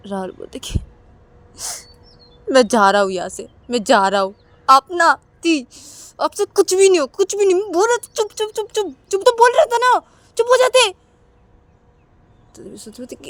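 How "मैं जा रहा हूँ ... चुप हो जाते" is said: high, loud shouting; angry shout. Acted. Brother yelling at sister, high loud anger. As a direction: storm off yelling.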